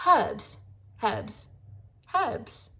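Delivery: "Herbs" tried out in mock English accent; imitated English accent. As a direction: rehearse a British-style "Herbs", word by word. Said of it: "I'm not saying it as good as them".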